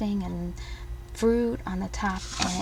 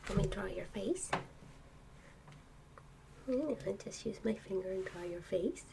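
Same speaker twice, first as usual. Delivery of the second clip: soft hushed whisper at bedtime; pillow talk; 14 dB quieter than usual. Real, not acted. Whispering to sleepless child.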